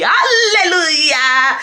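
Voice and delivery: slow, high, loud laughing hallelujah; mock praise. Praising Nigerian men with a loud, slow, laughing hallelujah. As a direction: shout it like church, laughing.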